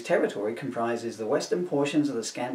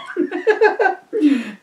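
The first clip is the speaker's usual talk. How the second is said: high giggle; 7 dB louder than usual. Real, not acted. Breaks up laughing mid-sketch.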